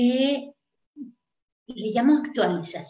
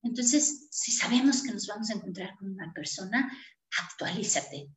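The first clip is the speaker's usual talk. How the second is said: ordinary talk, nothing marked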